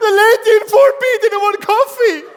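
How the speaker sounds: hammed-up high shout, laughing; mock steward outburst. Steward shrieks to the pilot about a refused coffee, hammed-up shout. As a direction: screech it like an offended steward tattling. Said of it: "Have you seen the steward do this?".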